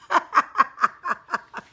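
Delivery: high, loud cackle; burst of laughter. Real, not acted. Laughs at typo comment.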